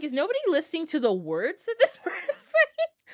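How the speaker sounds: high, laughing disbelief; mock exasperated; incredulous. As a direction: ask it half laughing in disbelief. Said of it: "I'm like".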